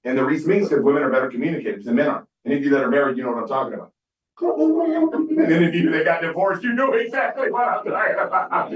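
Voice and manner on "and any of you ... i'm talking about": high, slow, laughing through the words. Real, not acted. Joking to the crowd about divorce, laughing high through it. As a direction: let the laugh take over the punchline.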